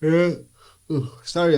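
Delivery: said through a big yawn. Real, not acted. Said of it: "I just yawned".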